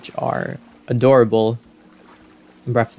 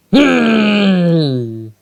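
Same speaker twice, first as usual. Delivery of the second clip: loud, high royal hmm; hammed-up kingly hum; booming hum; 8 semitones higher, 5 dB louder than usual. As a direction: let out a big surprised hmm. King peers at Link, shouting hum.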